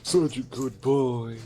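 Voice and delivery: flirty praise. Pretend. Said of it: "If you say it like that".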